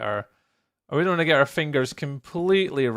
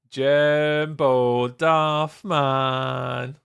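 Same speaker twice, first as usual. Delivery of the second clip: drawn-out name call; 4 dB louder, much slower than usual. Acted. Calling out viewer name.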